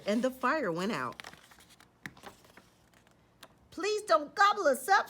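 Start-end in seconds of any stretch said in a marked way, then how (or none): 3.7-5.1 high, louder frightened squeal; squealing plea. Acted. Pigs begging the wolf not to eat them, squealing. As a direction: beg not to be eaten. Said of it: "squealed the three little pigs".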